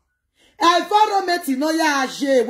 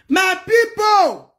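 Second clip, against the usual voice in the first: slow, loud, high call of my people; hailing the audience in a long shout; drawn-out shout; 7 semitones higher, 4 dB louder, much slower than usual. Acted. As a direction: stretch out people like calling a crowd. Calling out to viewers, drawn out.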